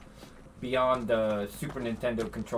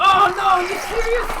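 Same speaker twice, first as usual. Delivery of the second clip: high loud outraged cry; shocked disbelief; 9 dB louder than usual. Real, not acted. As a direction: yelp it in disbelief. Gets hit by enemy.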